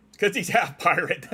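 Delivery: high, loud, laughed-out words; laughing. Real, not acted. Streamer laughing at the half-pirate joke, laughing. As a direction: talk through real laughter.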